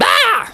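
short, high startled cry; startled yelp. Acted. Bear finding a cobra under rock, startled yelp. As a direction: yelp at a sudden snake.

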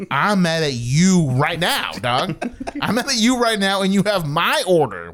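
worked-up retelling of being mad; retold angry outburst. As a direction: push each word in indignant disbelief. Retelling his angry outburst at driver.

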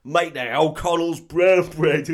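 loud, slow Cockney threat; loud mock Cockney menace; Cockney thug. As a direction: growl the threat like an East End heavy. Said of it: "one of them says".

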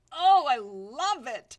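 loud, slow, drawn-out enthusiasm; big, loud swoon of enjoyment; gushing delight. Real, not acted. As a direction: stretch out every word with love. Gushing about languages.

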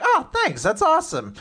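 high squeaky gushing thanks. Retold or imitated. Chica thanking Fuko, high squeaky voice. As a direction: squeak out your gratitude up high. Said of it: "And Chica's like".

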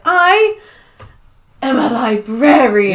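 high, loud mock-proud declaration; hammed-up pride, pause after the first word; sassy, proud. Imitating Evie answering O'Connell, sassy and proud. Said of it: "She's all sassy".

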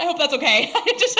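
loud, high, laughing words; helpless giggly apology; real laughter. Real, not acted. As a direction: burst out laughing while talking. Breaks up laughing at own retelling.

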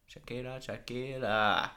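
slow echoing tannoy call of the name; drawn-out public address page; flat echoey pa page. Pretend. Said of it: "if they ever have to make an announcement".

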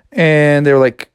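ordinary delivery

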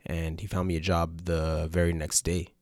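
ordinary talk, nothing marked

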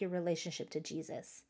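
normal talking voice

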